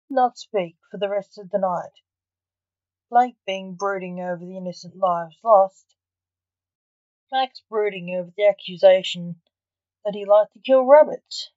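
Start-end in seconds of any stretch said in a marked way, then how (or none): none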